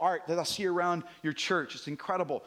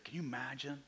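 hushed; 4 semitones lower, 7 dB quieter than usual. Real, not acted. Imagining Peter meeting Jesus' eyes.

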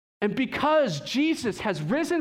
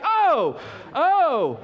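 sudden realization; 7 semitones higher, 7 dB louder than usual. Retold or imitated. Husband suddenly catching on.

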